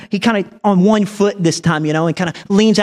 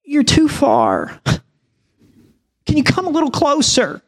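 put-on a scared kid asking him to come nearer; lightly voiced timid boy; timid little boy; 7 semitones higher than usual. Boy asks him to come closer.

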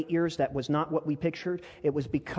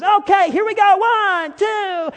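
much higher, loud bright countdown; energetic photographer countdown; 12 dB louder, slower than usual. Retold or imitated. Lindy counting down the photo, very high and loud. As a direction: count them in with big energy. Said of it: "brings the energy, and she says".

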